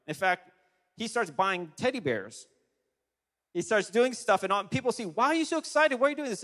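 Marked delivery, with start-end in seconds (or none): 5.1-6.4 imitating amazed onlookers, much higher pitch; quick, high-pitched incredulous questions, acted out; astonished questioning. People question Mark's excitement.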